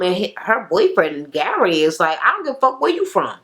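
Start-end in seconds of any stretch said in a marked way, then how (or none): 2.2-3.4 fast, high-pitched mock comeback; mock defiant retort. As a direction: fire back like the annoyed boyfriend, high and quick. Boyfriend's retort in the quarrel.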